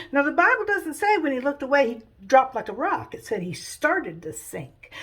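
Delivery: ordinary delivery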